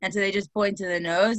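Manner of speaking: ordinary talk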